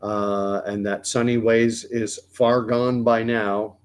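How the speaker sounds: ordinary talk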